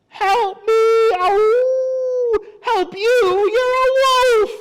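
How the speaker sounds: high, loud, wailing animal yells; very high frightened howling; panicked cries for help. Acted. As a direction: shriek it in hammed-up storybook terror. Frightened animals cry out at wolf.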